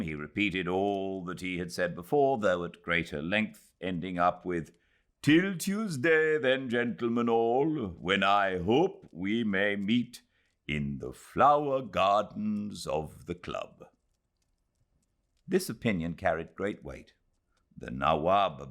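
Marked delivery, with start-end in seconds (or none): 5.2-13.9 higher, slow, gracious farewell voice; courteous old gentleman. Acted. Nawab bidding the gentlemen farewell till Tuesday, slow and gracious. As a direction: take leave graciously, slow and a bit raised.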